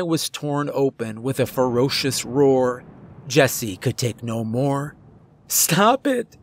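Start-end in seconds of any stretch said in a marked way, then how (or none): 5.5-6.4 understated narrator's scream, pitch leaping up. A narrator's reading. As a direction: cry out in sudden panic, held-back volume. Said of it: "screamed at the top of his lungs".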